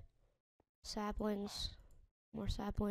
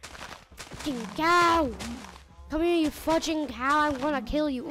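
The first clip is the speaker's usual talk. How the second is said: loud, higher, frustrated yell; 4 semitones higher, 9 dB louder, slower than usual. Real, not acted. Player chasing a cow, frustrated loud yell. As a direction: holler at the cow that won't die.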